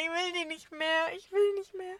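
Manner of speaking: high, whining mock despair; hammed-up whimper of defeat; whiny despair. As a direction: plead like a worn-out kid. Retold despair.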